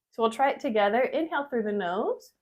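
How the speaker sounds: ordinary delivery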